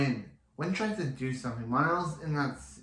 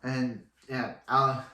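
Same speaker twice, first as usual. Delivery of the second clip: normal talking voice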